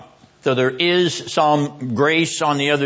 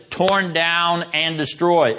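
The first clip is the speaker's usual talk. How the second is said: normal talking voice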